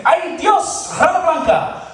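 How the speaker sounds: put-on high exclaiming Dominican mother, Spanish accent. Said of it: "she's like".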